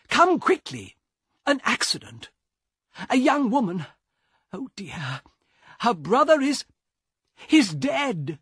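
high, breathless alarm; a shaken man fetching the guard; panicked passenger. Acted. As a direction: rush the news out in panic.

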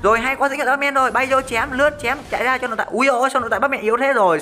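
shouting out each skill mid-teamfight; excited play-by-play. Real, not acted. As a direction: call the fight like a hyped caster.

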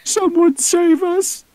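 very high, loud panicked scream; high squealing creature cry. Acted. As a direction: shriek for rescue in terror. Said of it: "a Packy screamed".

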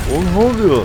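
high, loud alarmed question; alarmed shout. Real, not acted. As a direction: cry out in sudden alarm. Bomb thrown, alarm. (telaşla bağırarak)

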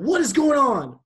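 loud, high alarmed yell; startled shout. Retold or imitated. Counselors bursting into the cabin, loud high yell. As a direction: counselor yelling as the light snaps on.

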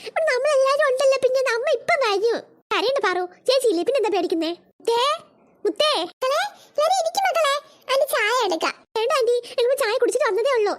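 usual speaking voice

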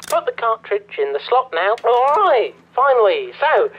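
normal talking voice

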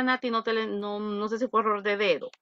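ordinary talk

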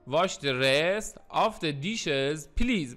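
slow, word-by-word teaching delivery; very slow model reading. Shown as a demonstration. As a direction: stretch out each word for learners. Teacher repeats example word by word.

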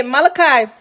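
ordinary talk

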